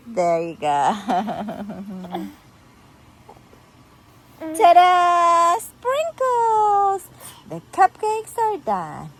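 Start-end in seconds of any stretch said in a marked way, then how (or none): 0.7-2.3 low, rippling little chuckle; giggle. Acted. Giggle after blown kiss. 4.4-7.1 loud, stretched-out ta-da; big drawn-out delighted flourish; triumphant reveal. Acted. Magical reveal of sprinkles.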